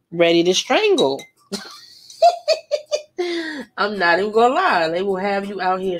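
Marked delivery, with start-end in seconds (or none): 2.2-3.7 bursts into a high laugh; real laugh at her own line. Real, not acted.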